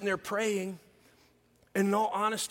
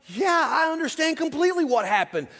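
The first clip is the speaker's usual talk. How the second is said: high, loud sarcastic snap back; mock certainty to shut her down; sarcastic retort; 8 semitones higher, 6 dB louder than usual. Son answers his mother on the phone, loud sarcastic retort. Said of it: "And I'm like".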